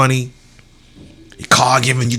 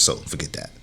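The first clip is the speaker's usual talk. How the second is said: dropped to a whisper; whispered aside; 10 semitones lower, 12 dB quieter than usual. Real, not acted. Vlogger brushing off a tangent, whispered. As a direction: toss it off under your breath. Dismissive aside under breath.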